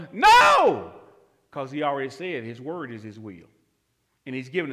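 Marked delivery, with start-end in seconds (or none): 0.1-0.9 a loud, high shout of no; emphatic shout. Real, not acted. Preacher to congregation, emphatic shouted no. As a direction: slam the door on doubt.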